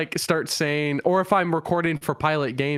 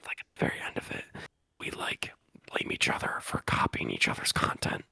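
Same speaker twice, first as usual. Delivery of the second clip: whispering; 6 semitones lower, 7 dB quieter than usual. Shown as a demonstration. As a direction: demo ASMR whisper. Demonstrating the quiet video idea.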